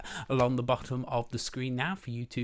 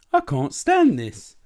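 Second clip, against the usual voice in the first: loud, higher, slow complaint; acted exasperated wail; exasperated bear; 7 semitones higher, 10 dB louder, slower than usual. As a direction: stretch out the protest, fed up. Mister Bear fed up with noise.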